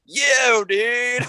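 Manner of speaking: mock American accent.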